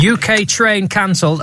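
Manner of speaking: crisp headline read; newsreader voice. Shown as a demonstration. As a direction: read it like a news anchor. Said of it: "I'm doing my newsreader voice now".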